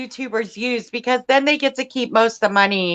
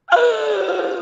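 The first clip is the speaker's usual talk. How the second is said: sharp high gasp of delight; excited gasp; 9 semitones higher than usual. Real, not acted. Cohost picturing Hobby Lobby clearance, delighted high gasp. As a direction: gasp at the thought of the sale. Imagining a big clearance sale.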